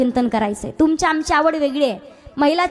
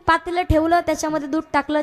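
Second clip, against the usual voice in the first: ordinary talk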